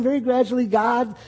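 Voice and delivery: ordinary talk, nothing marked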